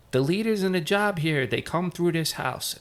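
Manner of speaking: high-pitched imitation of an old firefighter; Joe Selly's high-pitched voice. Old-timer boasts about the firehouse, high-pitched impression. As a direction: lift it well above your own voice, mimicking him. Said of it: "He had a high-pitched voice".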